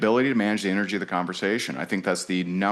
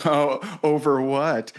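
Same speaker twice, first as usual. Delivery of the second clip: defensive retort; 5 semitones higher, 3 dB louder, slower than usual. Retold or imitated. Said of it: "she goes".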